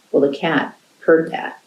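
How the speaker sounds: ordinary delivery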